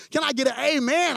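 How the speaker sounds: calling out for amen. Real, not acted. Preacher to congregation, loud rousing call. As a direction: pump up the room for an amen.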